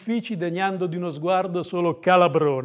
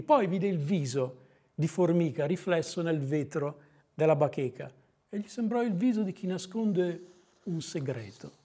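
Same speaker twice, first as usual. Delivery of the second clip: ordinary delivery, nothing marked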